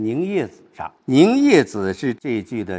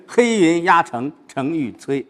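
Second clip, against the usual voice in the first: louder, shorter-held recitation; not drawn out; 4 semitones higher, 4 dB louder than usual. Shown as a demonstration. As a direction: recite it firmly without stretching the syllables. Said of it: "不是".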